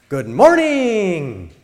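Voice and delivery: high, loud and slow, bursting with life; enthusiastic. Shown as a demonstration. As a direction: greet them like it's great to be alive. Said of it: "That's enthusiastic. It's great to be alive".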